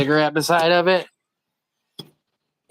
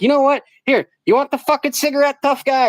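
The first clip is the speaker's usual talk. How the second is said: mock taunt; 9 semitones higher than usual. Taunting the guy before flicking the cigarette, sneering. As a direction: goad him like a bully.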